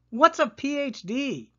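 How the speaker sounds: put-on high, eager child's question; Lily blurting high and loud; excited yell. A narrator's reading. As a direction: shout it out curious. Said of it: "yelled Lily".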